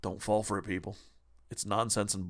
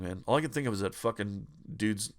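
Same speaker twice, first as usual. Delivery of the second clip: normal talking voice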